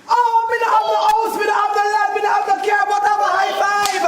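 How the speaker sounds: high, loud mock pidgin wail; mock broken-English lament. Teacher mock-wailing to class over lost bet. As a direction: ham up losing the prize.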